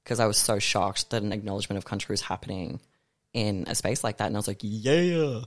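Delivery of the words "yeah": drawn-out, raised "yeah" whoop; retold whoop. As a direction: re-enact a surprised whoop, not at full volume. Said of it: "I did, like, a whoop".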